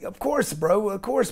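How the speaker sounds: light imitation of a laid-back son; casual bro reply. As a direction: toss it off like it's nothing.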